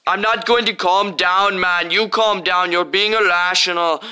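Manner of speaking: riled-up shout back; angry retort. Acted. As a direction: throw the calm-down back at him. Refusing to calm down, shouting back.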